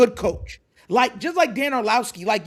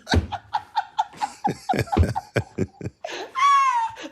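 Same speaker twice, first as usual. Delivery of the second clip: long high-pitched burst of laughter; high cackling laugh. Real, not acted. Cracking up at pundit.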